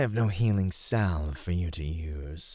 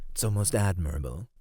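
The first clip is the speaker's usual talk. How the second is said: ordinary talk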